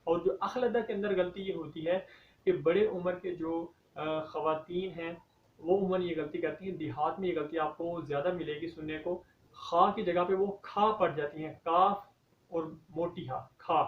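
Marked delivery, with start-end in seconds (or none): none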